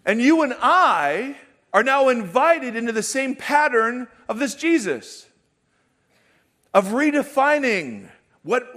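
a touch of full-force sermon; fervent preaching. Acted. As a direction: drive the invitation home. Preaching build again.

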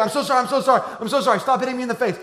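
hammed-up little sibling begging sorry over and over; frantic kid apology. Little siblings apologizing to big brother, mock frantic pleading.

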